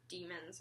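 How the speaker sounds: "demons" said with an "ee" sound, DEE-muhnz; alternate pronunciation. Shown as a demonstration. Unsure how the book's word is said, trying "demons" as DEE-muhnz. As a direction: sound out "demons" slowly with a long E. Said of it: "pretty sure it's pronounced demons".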